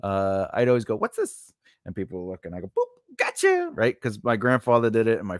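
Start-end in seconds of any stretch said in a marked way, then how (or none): 1.0-1.5 high, lilting lure; playful high question with a rise; teasing prank question. Retold or imitated. Acting out childhood prank lure. 2.7-3.7 very high, teasing squeak; spring the prank in a tiny high voice; silly high-pitched prank payoff; playful gotcha. Retold or imitated. Acting out childhood prank payoff.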